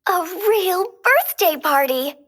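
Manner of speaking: high, eager little exclamation; excited fairy. Acted. Merryweather plans the birthday party, high and eager. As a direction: delight in the surprise plan. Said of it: "Merryweather said".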